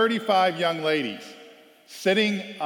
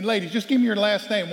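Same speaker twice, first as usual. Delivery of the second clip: louder, higher call to the team; impatient coach; 7 semitones higher, 7 dB louder than usual. Retold or imitated. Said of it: "I'm saying".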